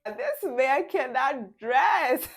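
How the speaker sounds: slow, high, loud mocking through laughter; a woman laughing at her man's outfit; laughing mockery. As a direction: drag it out, laughing at him. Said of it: "she starts laughing like".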